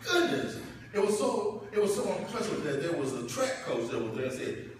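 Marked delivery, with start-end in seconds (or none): 0.0-0.6 amazed. Retold or imitated. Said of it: "I was like".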